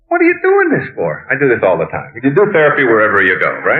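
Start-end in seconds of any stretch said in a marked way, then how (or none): 0.1-1.3 much higher, worked-up quoted waiter; excited waiter. Retold or imitated. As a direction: throw the question up high like a frantic waiter. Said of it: "He said".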